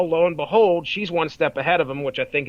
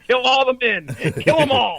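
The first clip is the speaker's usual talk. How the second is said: raised, higher mock battle cry; mock rallying cry; 7 semitones higher, 4 dB louder than usual. Women ordering the mob to wipe out the men, mock rallying cry. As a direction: call out the order with hammed-up force.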